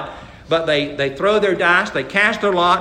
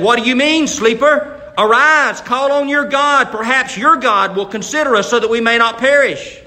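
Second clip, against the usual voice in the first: urgent call; 6 semitones higher, 5 dB louder than usual. Retold or imitated. Captain rousing sleeping Jonah.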